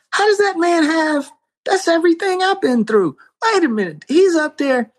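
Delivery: high, surprised exclamation; amazed disbelief. Retold or imitated. Hearing a stranger give his testimony, astonished and high. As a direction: react in astonishment, voice jumping up. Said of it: "I go".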